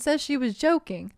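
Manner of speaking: normal talking voice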